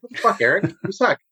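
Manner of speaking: a New Zealand try drifting Irish, pitched high; slipping into a light Irish lilt; Irish accent by mistake; accidental Irish accent. Shown as a demonstration. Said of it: "then it turns Irish".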